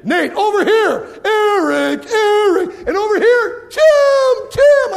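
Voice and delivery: rising shout across the room; building from called names to a full shout; calling out names. Retold or imitated. Placing cheerleaders, calling out names.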